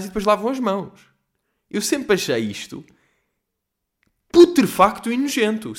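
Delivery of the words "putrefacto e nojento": a hint of the prime minister's grave tone, higher and louder; mock official disgust; quoting Costa. Quoting the prime minister to listeners, mock solemn.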